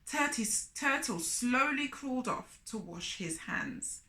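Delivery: ordinary delivery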